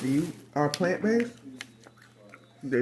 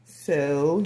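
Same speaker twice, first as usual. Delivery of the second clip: ordinary delivery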